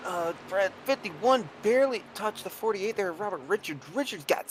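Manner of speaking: sudden high surprised gasp. Real, not acted. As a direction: react to the crash. Reacts to contact on track.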